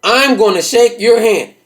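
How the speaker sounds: slow, deliberate character voice; brother's put-on voice. Brother congratulating the visitor, slow put-on voice. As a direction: draw it out as the brother. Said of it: "He saying".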